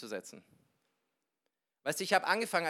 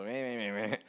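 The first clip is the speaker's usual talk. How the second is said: mock wordless Bavarian grumble; grumbling Bavarian man; 5 semitones lower than usual. Bavarian passing behind him in the supermarket, mock grumbling. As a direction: imitate a grumpy local muttering gibberish. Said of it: "so ein richtig bayerischer Typ".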